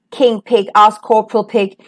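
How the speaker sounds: usual speaking voice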